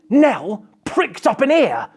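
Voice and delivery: ordinary delivery, nothing marked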